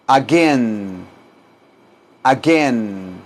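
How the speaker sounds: "Again" low and drawn out, with hard g; pronunciation model. Shown as a demonstration. Teacher tells students to say "Again", low and drawn out. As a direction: hold the second syllable of "Again", letting it fall. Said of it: "Díganlo".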